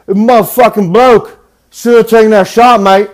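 loud, high-pitched mock English lad; English bro accent. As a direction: heckle like a rowdy English bro. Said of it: "broy dudes from, like, England".

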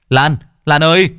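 loud, high call of alarm. A narrator's reading. Neighbour rushes in to check on Lan, alarmed shout. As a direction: call out to her in a panic. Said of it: "chạy sang xem thì hoảng hốt".

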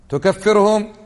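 high, sharp mock challenge; objector's challenge. Preacher voicing a critic accusing him of takfir, high and challenging. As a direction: throw the accusation back as the critic.

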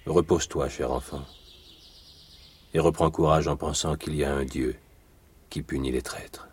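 tender comfort. Acted. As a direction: gently lay a hand on her grief. Count comforts the girl.

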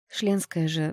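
ordinary delivery

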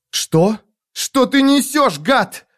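high-pitched, outraged demand; furious girl. A narrator's reading. Girl leaping up to confront the man she blames, furious. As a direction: fire it back in a high, angry voice.

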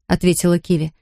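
plain reading voice

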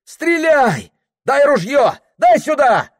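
high frantic yelling; panicked shout. Acted. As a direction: yell it in a rush of panic. Panic on the hunt. (испуганный крик)

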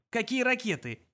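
high-pitched, dismissive question; scoffing question. A narrator's reading. Zaphod dismisses Trillian's worry about missiles, scoffing. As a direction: wave the worry away.

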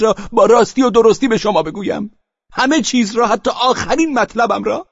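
high, loud, urgent pressing voice; agitated plea. Acted. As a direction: push him hard, near breaking with agitation. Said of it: "با صدای گرفته و پرهیجان".

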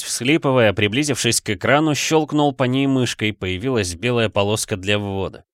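ordinary delivery

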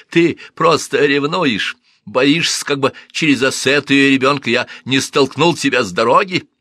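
high, loud furious blurt; angry outburst. Acted. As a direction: blurt it out in rage. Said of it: "Он выпалил это".

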